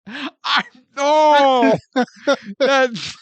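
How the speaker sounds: high, slow laughing protest. Real, not acted. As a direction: break up laughing while complaining. Laughing, playful outrage.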